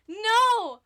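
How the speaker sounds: sudden loud, high protest; a real exasperated cry; startled loud no. Real, not acted. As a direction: yelp it out in dismay. Squats redeemed again mid-stream.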